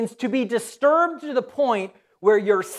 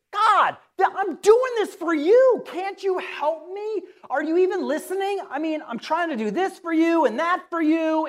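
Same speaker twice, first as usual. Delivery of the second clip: worked-up believer pleading with God, imitated and pitched high; worked up complaint; 7 semitones higher than usual. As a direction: vent frustration in a rising complaint. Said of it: "You probably got so worked up".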